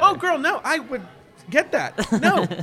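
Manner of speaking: high, loud, laughing protest; emphatic no. Real, not acted. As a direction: shoot it down with sassy disbelief. Reacting to friend's theory.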